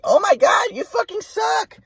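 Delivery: very high, drawn-out mock wail as mom; exasperated mother imitation. Mom cutting off son's explanation, exasperated high mock wail. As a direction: mimic mom's high-pitched exasperation, stretching the end. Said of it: "she's like".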